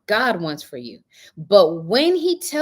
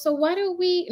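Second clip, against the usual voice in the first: son's voice; 13 semitones higher than usual. Retold or imitated. Son quoted, high pitch.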